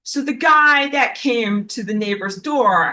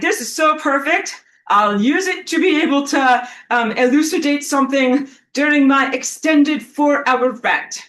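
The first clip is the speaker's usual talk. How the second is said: excited self-quote; 6 semitones higher, 5 dB louder than usual. Retold or imitated. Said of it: "I'm like".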